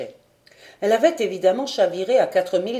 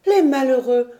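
higher, louder cry of dismay; exclaimed cry; 5 semitones higher, 7 dB louder than usual. A narrator's reading. Lady Helena learns of the wrecked crew, crying out. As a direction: cry out in pity, pitched up. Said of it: "s'écria Lady Helena".

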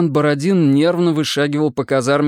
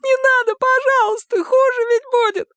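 very high tearful begging; wailing plea. A narrator's reading. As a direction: beg frantically in a thin, crying voice. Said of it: "вдруг заголосил Женя". (плаксивая мольба тонким голосом)

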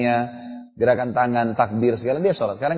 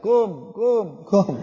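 put-on toddler's salam; 5 semitones higher, much slower than usual. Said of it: "anak saya sudah bilang".

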